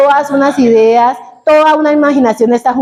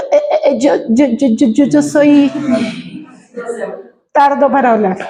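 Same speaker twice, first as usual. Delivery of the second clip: stammering. Acted. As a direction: imitate a stutter on the first words.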